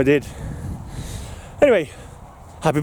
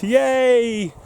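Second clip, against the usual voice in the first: yay; 8 semitones higher than usual. Real, not acted. Cheering a prize on its way.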